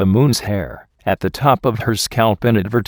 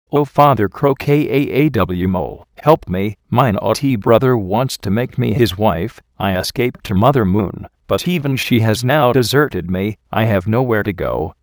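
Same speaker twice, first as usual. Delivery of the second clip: ordinary delivery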